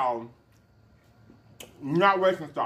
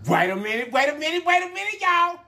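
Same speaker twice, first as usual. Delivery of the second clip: rising higher with each repeat; building excitement; 10 semitones higher, 4 dB louder than usual. Acted. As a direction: climb up, more hyped each time.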